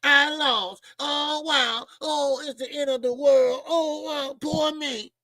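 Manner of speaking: high, slow whiny lament; mock a self-pitying loser; a hammed-up whine of poor me; mock whining. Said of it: "People act like".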